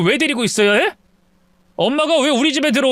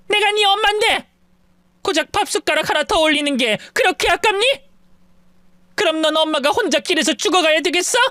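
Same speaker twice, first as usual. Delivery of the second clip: high, shocked mother crying out; 8 semitones higher than usual. A narrator's reading. Mother shocked her son won't take her in, high cry. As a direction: cry out in disbelief at your son. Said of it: "경악에 가까운 얼굴로 소리를 질렀죠".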